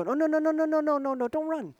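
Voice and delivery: high, rapid string of gentle protests; pleading reassurance. Retold or imitated. Preacher to a fleeing hurt bunny, pleading reassurance. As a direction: coax a scared creature not to flee. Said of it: "you do this kind of silly thing".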